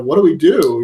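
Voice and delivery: retold alarm of stunned friends; mock shocked. Retelling friends shocked by the head, mock panic. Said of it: "they're, like, all shocked and vomiting".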